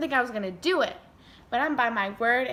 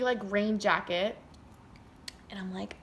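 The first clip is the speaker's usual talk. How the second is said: usual speaking voice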